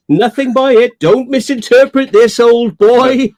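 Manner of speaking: high, loud mock-posh toff voice; posh old chap. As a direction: put on a plummy gent. Mock posh reassurance to friend.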